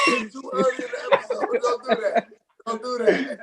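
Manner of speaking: laughing through the protest; giggling while telling him to stop. Real, not acted.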